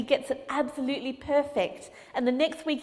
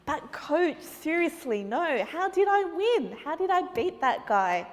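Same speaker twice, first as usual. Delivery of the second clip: put-on baffled boy; 4 semitones higher than usual. Boy baffled by his win.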